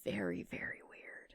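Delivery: unvoiced whisper. Real, not acted. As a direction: breathe it, no voice. Whispered aside.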